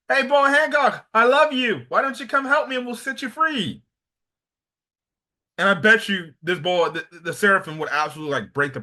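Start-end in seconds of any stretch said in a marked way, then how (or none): 0.1-3.8 higher put-on hero voice calling out; hammed-up, upbeat love declaration; Luffy's cheery pitch. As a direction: imitate a hero sweet-talking a recruit. Acting Luffy's scheming love declaration.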